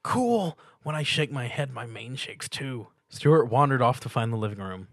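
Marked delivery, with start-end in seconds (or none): 0.0-2.9 dreamy pony voice. A narrator's reading. Pinkie voiced.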